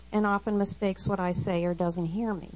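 usual speaking voice

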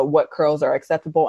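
ordinary talk

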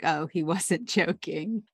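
slow, amused, a laugh breaking in; laughing realization. Retold or imitated. Miranda spotting the gold suit in the closet, slow amused laugh. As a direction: let the laugh slip out as it dawns on you.

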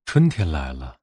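ordinary delivery

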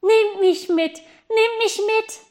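high, loud plea; girl calling up to the geese, high and loud. Acted. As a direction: beg loudly to be taken along. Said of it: "Und sie rief als Antwort".

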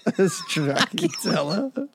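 put-on slow Italian accent on Stracciatella. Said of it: "worst Italian accent of the world".

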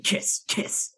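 soft whispered urging; whispered plea. Retold or imitated. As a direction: breathe the words urgently. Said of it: "just going".

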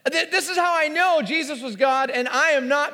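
ordinary talk, nothing marked

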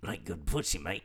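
lightly put-on Australian accent; a hint of a jokey Australian accent; mock Australian accent. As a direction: tease with an Aussie twang. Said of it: "I can say one thing in a good Australian accent".

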